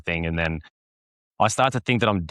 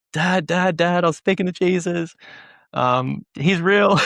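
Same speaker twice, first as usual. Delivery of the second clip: excited retelling; 10 semitones higher, slower than usual. Retold or imitated. Excited call to dad.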